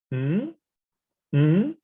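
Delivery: "mm" gliding upward like a question; rising tone. Shown as a demonstration. Teacher reviewing the second one, rising tone. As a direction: raise "mm" from middle to high. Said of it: "rising tone".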